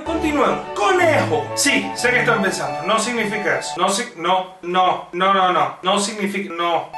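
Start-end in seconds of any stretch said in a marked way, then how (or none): none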